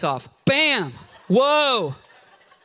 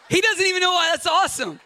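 normal talking voice

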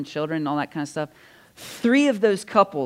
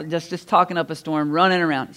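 ordinary delivery

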